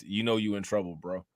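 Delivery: ordinary talk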